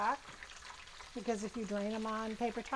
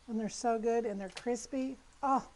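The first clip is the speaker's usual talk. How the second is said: normal talking voice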